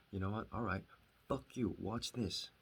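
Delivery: retold defiance. Hurt younger brother recalling his vow to show him, defiant. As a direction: throw down the challenge, drawing out the last words. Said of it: "flicked the switch inside of me".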